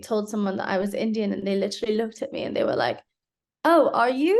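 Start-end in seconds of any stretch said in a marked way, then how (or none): 3.6-4.4 imitating someone's incredulous disbelief, the second line slower; a high, louder, put-on voice of disbelief; mock surprise. A stranger doubting she is Indian, with mock incredulity.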